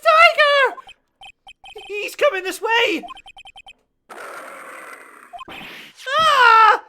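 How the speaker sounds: loud, high panicked yell; panicked shouting. Acted. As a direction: scream in terror at the tiger. Tiger attacks, panicked yelling.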